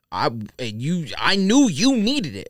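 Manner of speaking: ordinary talk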